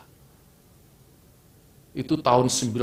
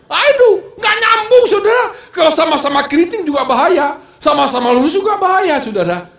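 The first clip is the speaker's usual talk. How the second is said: high, loud preaching with laughs along the way; fiery preaching; 11 dB louder than usual. Acted. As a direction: preach it out to the congregation. Preacher at full force. (khotbah berapi-api dengan suara tinggi dan keras)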